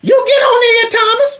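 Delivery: high, scolding cartoon maid. Voicing the cartoon maid character.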